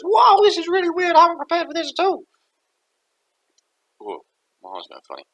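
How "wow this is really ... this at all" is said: very high, loud, fast squeaky voice; high, fast and amazed; bewildered spirit. Acted. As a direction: gabble in cartoon astonishment. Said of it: "And he goes".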